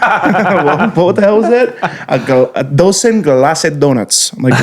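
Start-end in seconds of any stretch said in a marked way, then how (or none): 0.0-1.7 high-pitched, laughing disbelief; mock bafflement. Donut worker reacting to a strange order, mock baffled. 2.1-4.3 slowed-down mock Spanish-accented order; imitated Spanish accent. Customer repeating the donut order, slow imitated Spanish accent. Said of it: "if Spanish is their first language".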